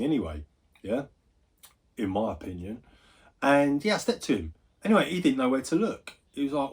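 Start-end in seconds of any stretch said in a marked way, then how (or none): none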